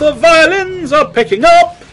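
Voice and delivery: high rising panicky call; alarmed. Real, not acted. Still spooked by the music, calls it out high.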